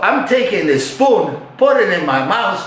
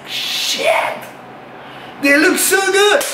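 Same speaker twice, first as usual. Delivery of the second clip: awed gasp; 13 semitones higher, slower than usual. Retold or imitated. Sees them shirtless, awed.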